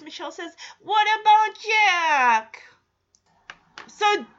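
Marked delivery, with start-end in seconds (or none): 0.8-2.6 put-on high, slow, drawn-out toddler plea; little child imitation. Michelle asking Jesse about her toy, imitated toddler whine. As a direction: stretch out "Jack" like a small child.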